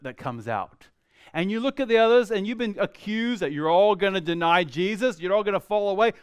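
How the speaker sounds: ordinary delivery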